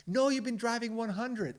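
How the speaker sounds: loud, high, argumentative retort; insistent wife. Retold or imitated. Wife exaggerating her husband's speed, insistent retort. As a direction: insist like a wife who won't back down.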